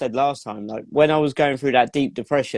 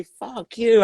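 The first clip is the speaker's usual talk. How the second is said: retold angry shout; 9 semitones higher than usual. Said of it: "out loud".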